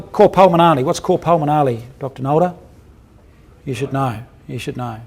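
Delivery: normal talking voice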